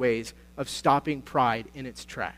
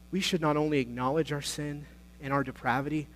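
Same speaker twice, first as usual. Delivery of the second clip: ordinary talk, nothing marked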